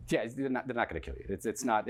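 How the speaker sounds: ordinary delivery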